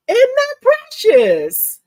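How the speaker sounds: high, loud, slow gushing. Real, not acted. Embroiderer gushing to viewers over the finished design. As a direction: gush over it, drawn out.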